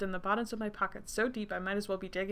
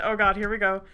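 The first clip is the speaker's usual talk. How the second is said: ordinary delivery, nothing marked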